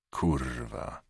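soft and low; a grim, hushed swear; muttered curse. Acted. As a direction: swear quietly to yourself. Cop swears at grim news.

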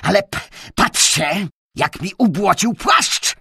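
high, loud accusing whine. A narrator's reading. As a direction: point the blame at him. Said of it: "dodał oskarżycielskim tonem".

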